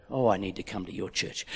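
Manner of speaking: low, soft aside; muttered reply. Acted. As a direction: voice a listener quietly, under the breath.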